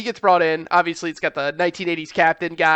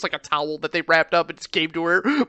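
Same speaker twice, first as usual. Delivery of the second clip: ordinary talk, nothing marked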